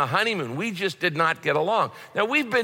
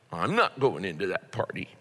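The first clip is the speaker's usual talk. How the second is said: low, soft, sullen pouting; a pouting son mutters his refusal low and soft; sulky refusal; 9 semitones lower, 5 dB quieter than usual. Retold or imitated. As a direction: sulk like a grumpy older brother. Older brother refusing the party.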